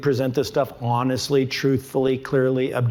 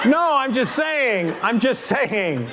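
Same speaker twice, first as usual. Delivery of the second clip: laughing joke; 10 semitones higher, slower than usual. Real, not acted. Joking with audience about wife.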